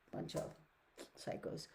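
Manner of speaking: breathy whisper "psychos"; whispered verdict; whispered aside. Real, not acted. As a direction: mutter the insult under your breath. Muttered judgment under her breath.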